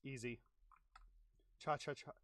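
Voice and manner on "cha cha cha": breathy whispered cha cha cha like the Jason sound cue; whispered chant. Retold or imitated. They're adding cha cha cha.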